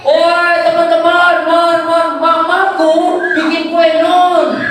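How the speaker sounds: high, drawn-out shout to friends; singsong child's announcement, loud and stretched; calling out. Acted. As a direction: holler it like an excited child across the village.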